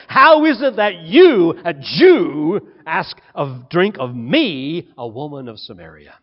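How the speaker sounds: loud scornful challenge that fades to a sneer; contempt. Acted. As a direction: throw the question back at him with disdain. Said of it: "You can hear her contempt".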